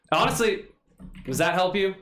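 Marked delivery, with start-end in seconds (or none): none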